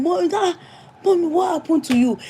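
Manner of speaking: high, fast anxious plea; a child pleading with his crying mother; worried son. Retold or imitated. Son asking his crying mother what happened, high and anxious. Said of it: "He now called my son".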